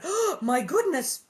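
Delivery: slow, loud, high gasp of alarm; cried out in surprise. A narrator's reading. Ma seeing bugs on Carrie, startled and loud. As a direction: blurt it, startled. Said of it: "Then she cried out".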